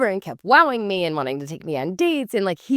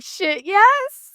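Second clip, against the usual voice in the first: excited yes; 4 dB louder than usual. Retold or imitated. Quoting her eager reply.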